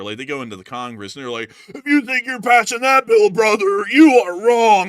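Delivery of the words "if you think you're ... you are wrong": loud, high mock wrestling rant; hammed-up promo bellow; WWE-style politician. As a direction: shout it like a wrestler on the Congress floor. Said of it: "acts like they're a W W E commentator".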